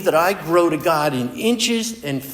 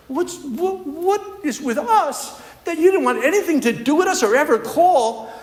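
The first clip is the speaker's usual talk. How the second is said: pleading questions, rising higher and higher; wife pouring out years of hurt, pitch climbing, a laugh breaking through; ex-wife's hurt questions; 7 semitones higher than usual. Retold or imitated. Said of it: "this is her talking now".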